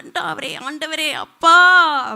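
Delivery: drawn-out wailing, slow and loud; mock crying. Preacher imitating believers crying to God, mock wail. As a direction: wail to the Lord, hammed-up. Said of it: "அப்படின்னு அழுவோம்".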